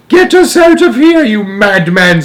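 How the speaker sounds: very high, loud screech; grandma screaming. A narrator's reading. Grandma demanding escape from Wonka, screaming. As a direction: scream it at the madman. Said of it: "screamed Grandma Josephine".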